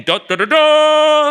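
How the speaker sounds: loud, high, slow trumpet fanfare; hummed fanfare. Acted. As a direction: blast a mock royal fanfare. Fanfare for the king.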